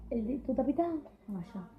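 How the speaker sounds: normal talking voice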